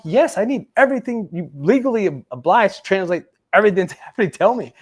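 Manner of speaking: high, loud, emphatic insistence; exasperated demand. Retold or imitated. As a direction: insist loudly at a useless translator.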